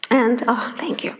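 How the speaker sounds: real tearful whisper, too moved to speak; choked whisper. Real, not acted. Thanking the crowd while overcome, a tearful whisper. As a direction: whisper through tears, barely holding on.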